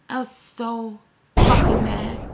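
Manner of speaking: slow, swelling angry shout; exasperated, drawn out, blowing up; angry outburst. Real, not acted. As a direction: lean hard and loud on the swear word. Reliving her anger at being shut out.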